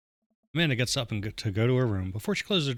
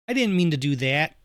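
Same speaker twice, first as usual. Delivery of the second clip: higher, louder upper Midwest demo; upper Midwest accent; 6 semitones higher, 5 dB louder than usual. Shown as a demonstration. Player demonstrates wide-mouthed A's, upper Midwest accent. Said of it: "the upper Midwest accent with a lot of".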